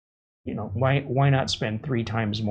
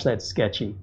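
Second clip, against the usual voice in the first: softer, lower, stressing "that's" with a fall; muttered aside; 4 semitones lower, 5 dB quieter than usual. Shown as a demonstration. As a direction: drop the voice on "that's sketchy", stressing "that's" and falling.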